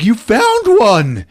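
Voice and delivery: high, stretched-out mock amazement; amazed delight. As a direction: exclaim it high and slow, overjoyed. Acts out Francis amazed at clover.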